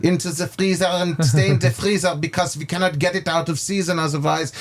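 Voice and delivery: lightly put-on German accent, chef rattling on. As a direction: imitate the chef's German accent while he explains. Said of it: "How do you like his, uh, little, uh, accent?".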